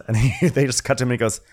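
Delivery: ordinary talk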